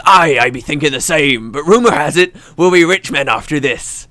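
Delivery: put-on pirate accent gone rustic; pirate accent drifting farmer. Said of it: "turn a little bit farmer when I'm doing a pirate accent".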